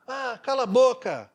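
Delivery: sudden loud high shout at mom; bratty yell. Retold or imitated. Spoiled boy shouting at his passive mother, loud and high. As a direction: snap at the mother like a spoiled brat.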